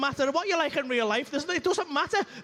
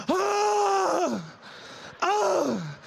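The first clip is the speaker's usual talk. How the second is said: orgasm yell; 6 semitones higher than usual. Retold or imitated. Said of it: "that he's just like".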